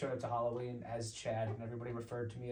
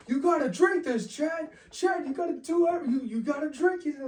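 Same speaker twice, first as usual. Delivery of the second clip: much higher, loud peer-pressure chant; pushy hype; 11 dB louder than usual. Retold or imitated. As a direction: goad him on, rowdy and insistent. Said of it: "They're like".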